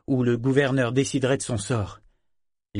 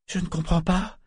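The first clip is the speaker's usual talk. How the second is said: higher, puzzled cry; baffled exclamation; 7 semitones higher than usual. A narrator's reading. Vivacia questions the galley's pursuit, exclaiming. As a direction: exclaim in confusion, pitched up. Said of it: "s'écria Vivacia".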